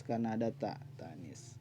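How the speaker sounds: usual speaking voice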